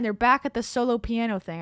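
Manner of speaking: ordinary talk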